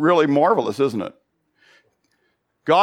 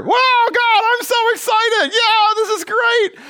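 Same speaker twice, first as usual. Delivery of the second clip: much higher, squealing mock excitement; mock excited Peter; 3 dB louder than usual. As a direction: play Peter as an over-the-top giddy fan. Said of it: "You're Peter".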